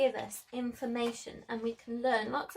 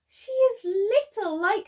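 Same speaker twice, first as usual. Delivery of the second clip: little lion thinking; 10 semitones higher, 7 dB louder than usual. Acted. Narrator voices Lizzie watching the girl. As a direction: make Lizzie sound small and wistful. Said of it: "thought Lizzie".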